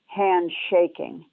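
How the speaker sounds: usual speaking voice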